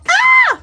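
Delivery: high, loud shrieking scream; a woman's scream of terror. Acted. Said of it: "Woman screamed".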